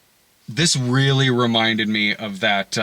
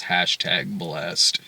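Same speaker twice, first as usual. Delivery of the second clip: slow, low, soft "Hashtag blessed."; mock Martha Washington; 7 semitones lower, 6 dB quieter, much slower than usual. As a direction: draw it out as a smug caption. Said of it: "she says".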